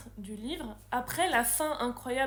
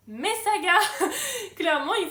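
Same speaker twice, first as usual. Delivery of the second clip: laughing; 7 semitones higher, 9 dB louder than usual. Real, not acted. Laughing at her own saga theme.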